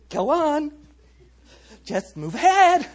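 high, silly sing-out order; mock smiling Jesus. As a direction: cheerily shoo them along in a put-on high voice. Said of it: "did this with a smile on his face".

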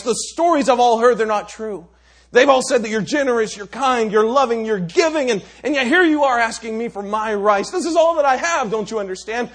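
loud, high, bitter tirade; ranting beggar. Acted. Beggar scolds the Raja for asking his rice, loud and bitter. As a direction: pour out the grievance.

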